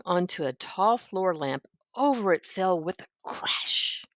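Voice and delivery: usual speaking voice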